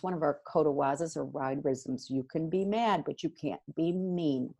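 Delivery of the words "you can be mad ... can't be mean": usual speaking voice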